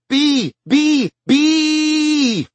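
put-on high, loud shrieks of a scared child; child shrieking. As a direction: shriek the warning like a frightened girl. Child screaming about a bee.

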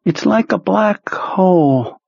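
high, slow, drained voice of a sick man; sick psychic Perlmutter. Acted. Ill telepath reporting to his boss, high and drained. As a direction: sound weak and washed out.

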